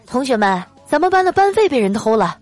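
high-pitched, mock-stern announcement; angry class teacher. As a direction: play an indignant teacher addressing the class. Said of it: "班主任啊突然怒气冲冲地走进教室". (假装怒气冲冲的班主任)

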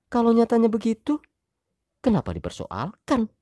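high wife voice, defiant. Acted. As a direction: answer back with a challenge.

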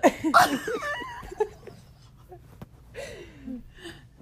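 high squealing laughter; laughing fit. Real, not acted.

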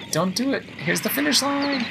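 rising high toward the finish; tense excitement. Real, not acted. Player nearing the finish in first, excited.

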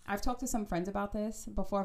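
ordinary talk

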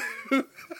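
high cracking laugh. Real, not acted. Laughing at his own reaction to the show. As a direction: burst out laughing.

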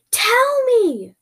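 very high, loud demand; shouting. Acted. Shouting in the creature's face for answers, loud. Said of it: "shouted in their face".

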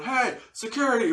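mock high-pitched call; calling for help. Performer on the mic calling security, mock high call.

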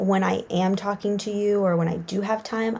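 usual speaking voice

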